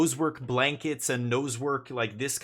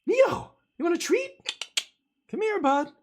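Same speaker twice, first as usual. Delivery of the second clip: much higher, louder excited call to the dog; really excited tone; 6 dB louder than usual. Real, not acted. Owner calling his dog Neo for a treat, excited high call. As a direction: sound thrilled so the dog comes running. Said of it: "If I use the really excited tone".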